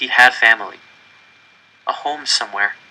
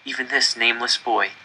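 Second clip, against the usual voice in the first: usual speaking voice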